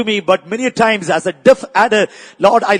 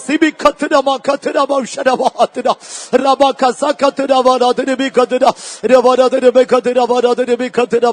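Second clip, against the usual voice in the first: praying in tongues; 4 semitones higher than usual. Acted. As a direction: pour it out as ecstatic prayer. Preacher prays in tongues again.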